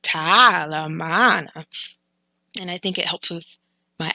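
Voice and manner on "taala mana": "Taala mana" stretched very slowly; playful practice. Shown as a demonstration. Teacher playing with the sound in front of a mirror, slow. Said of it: "play around in the mirror".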